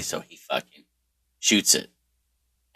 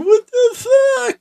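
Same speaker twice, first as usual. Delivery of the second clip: loud, slow, high sobbing wail; sobbing cry; 6 dB louder, much slower than usual. Retold or imitated. Boy injured on the ice, sobbing out loud in shock. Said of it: "I'm sobbing".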